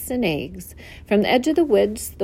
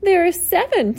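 goose voice; 10 semitones higher than usual. Acted.

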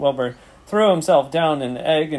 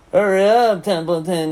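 loud, high mocking mimicry; Wilbur; 5 semitones higher, 6 dB louder than usual. A narrator's reading. Rat imitating the pig, mocking. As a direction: throw his plea back in a put-on voice. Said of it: "He began imitating Wilbur's voice".

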